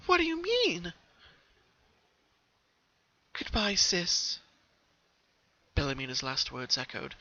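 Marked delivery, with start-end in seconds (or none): 0.0-0.9 high, confused question; high pony voice, puzzled; puzzled Pinky. A narrator's reading. Pinky asks ghost. 3.3-4.4 slow, higher farewell; fading ghost sister. A narrator's reading. Ghost fades away.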